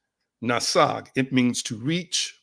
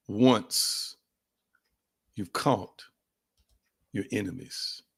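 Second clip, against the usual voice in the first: slow dramatic question; 5 semitones lower, slower than usual. Shown as a demonstration. Preacher posing the question to listeners, slow and low. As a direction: draw the question out, hushed. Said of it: "I got a question to ask you".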